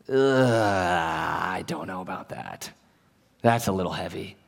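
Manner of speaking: low, soft groan of hesitation; reluctant doubt. Acted. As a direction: drag out the ugh, then demur quietly.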